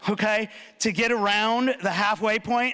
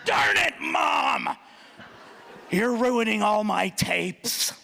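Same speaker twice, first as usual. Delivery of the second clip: put-on kid yelling back; much slower than usual.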